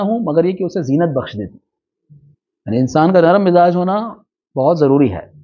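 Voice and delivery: ordinary talk, nothing marked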